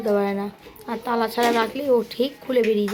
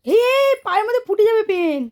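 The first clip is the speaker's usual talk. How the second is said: alarmed warning; 8 semitones higher, 5 dB louder than usual. Real, not acted. Mother alarmed child may hurt foot.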